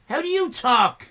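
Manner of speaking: normal talking voice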